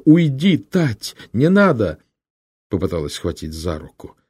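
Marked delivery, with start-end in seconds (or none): 0.0-2.0 sister cries out in panic, high and loud; desperate shout. Acted. Fila screams at her brother to stop, loud and high. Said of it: "крикнула".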